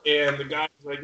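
usual speaking voice

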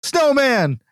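high, hammed-up holler; hollering bandmate. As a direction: call it out like an agitated bandmate. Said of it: "comes down and is like".

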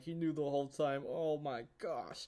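hammed-up heartbroken fan; retold tearful reaction. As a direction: act near tears, pitch lifting and words stretching. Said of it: "I was tearing up".

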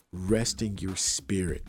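ordinary talk

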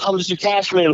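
put-on high, awed little-kid voice; a star-struck boy thanking the big boss; starstruck kid. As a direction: gush like a kid in a candy store meeting his hero. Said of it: "still this little kid, you know, at the candy store".

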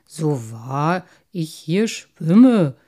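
slow, loud carp oath; emphatic, stretched fish vow; solemn protesting. A narrator's reading. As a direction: swear it with ponderous weight. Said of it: "beteuerte der Karpfen".

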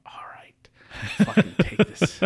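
breathy resigned whisper. Retold or imitated. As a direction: whisper a reluctant yes. Whispered reluctant agreement.

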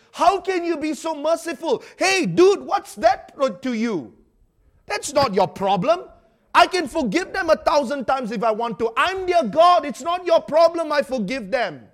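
loud, high, shouting God voice; God rebuking Jonah. Acted. God shouts back at nosy Jonah, loud and high.

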